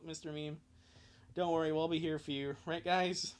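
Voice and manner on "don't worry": normal talking voice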